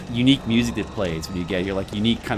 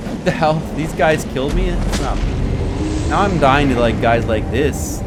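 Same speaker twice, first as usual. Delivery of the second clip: frustrated outburst; 7 semitones higher, 6 dB louder than usual. Real, not acted. Dies to weak enemies.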